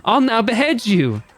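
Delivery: high, singsong showman verdict; gleefully cruel show host. Acted. Host gives Boy A the death verdict, theatrical glee. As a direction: announce doom like a prize.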